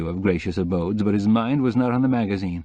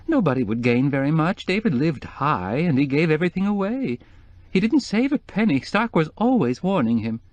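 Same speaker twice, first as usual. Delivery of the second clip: sniffling; 7 semitones higher than usual. A narrator's reading. Sniffing after tears.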